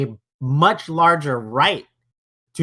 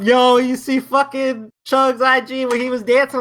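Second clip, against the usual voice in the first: high-pitched imitation of a fan; raised, mimicking voice; mock excited fan; 9 semitones higher than usual. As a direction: hype it up like a gossiping follower. Acts out excited fans messaging him.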